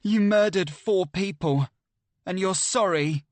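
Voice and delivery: high, loud, accusing snarl; angry snarl. A narrator's reading. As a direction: hurl the accusation at him. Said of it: "snarl in anger".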